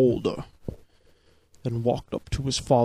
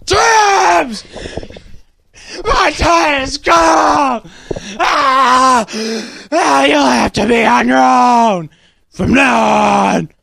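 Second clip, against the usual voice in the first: high, slow, agonized yelling; dying father; 12 semitones higher, 12 dB louder, slower than usual. A narrator's reading. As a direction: wail out last words in hammed-up agony. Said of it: "said in a low, weary voice".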